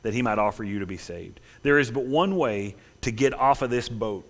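ordinary delivery

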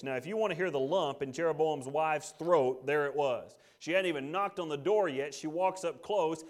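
ordinary talk

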